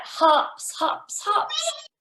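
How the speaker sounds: high, bouncing hops; playful high hopping voice; bouncy hops. Acted. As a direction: make each word hop up high. Imitating a bunny's hops.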